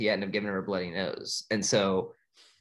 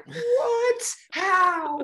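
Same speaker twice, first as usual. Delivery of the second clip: much higher and louder, a cartoon gasp of disbelief; shocked sharks; 4 dB louder than usual. Sharks stunned by Dory, shrieking surprise. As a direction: squeal it in total shock. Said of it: "And they're like".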